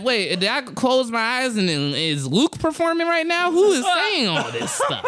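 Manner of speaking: high-pitched comic bewilderment; mock confusion. Comic doubting who is onstage, acted bewilderment. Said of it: "I was confused".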